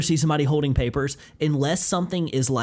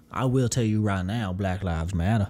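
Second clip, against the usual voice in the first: put-on old Southern accent; 8 semitones lower than usual. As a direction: play a genteel plantation Southerner. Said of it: "imagine somebody with that accent going like".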